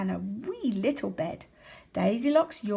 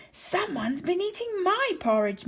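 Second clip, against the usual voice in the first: Mama Bear, higher and plaintive; Mama Bear crying about her porridge in a higher voice; medium-sized Mama Bear voice; 9 semitones higher than usual. A narrator's reading. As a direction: cry it out like a fussing bear mother. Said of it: "Mama Bear cried in a medium-sized voice".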